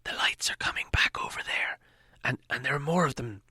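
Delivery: urgent stage whisper; loud whisper. A narrator's reading. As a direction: hiss the warning in a loud whisper. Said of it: "said in a loud whisper".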